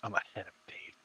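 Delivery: gleeful little whisper. Real, not acted. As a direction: whisper it like a smug secret. Gloating over the anime.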